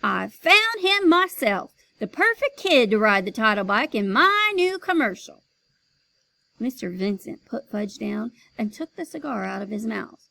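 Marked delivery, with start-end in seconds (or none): 0.0-5.4 proud announcement. Acted. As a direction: announce it grandly. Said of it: "He announced".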